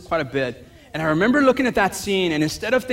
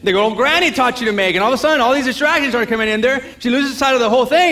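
normal talking voice

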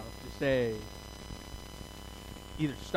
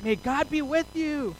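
mock blessing; 9 semitones higher, slower than usual. Dismissive blessing, walking away.

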